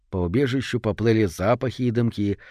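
ordinary delivery, nothing marked

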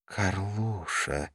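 slow, soft murmur; hushed, drawn-out mutter. A narrator's reading. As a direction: murmur the name slowly to yourself. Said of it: "пробормотала Карла".

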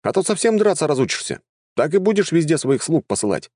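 high, exasperated scolding; indignant. Acted. Healer scolding necromancer for always sending servants, indignant. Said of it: "возмутился я".

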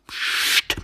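long voiceless "shh"; urgent hissing hush; hissed shush. Acted. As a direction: cut him off with a shush. Hidden man shushes the other.

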